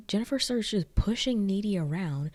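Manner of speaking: ordinary talk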